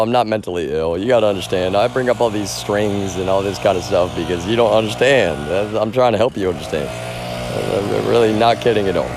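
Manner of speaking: ordinary delivery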